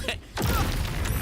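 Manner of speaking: high, loud laugh burst; burst of laughter. Real, not acted. Laughs at chat's answer.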